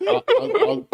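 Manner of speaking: high, breaking into laughter; can't get words out for laughing. Real, not acted. Laughing while answering.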